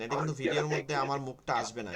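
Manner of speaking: ordinary delivery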